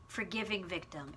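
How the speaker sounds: usual speaking voice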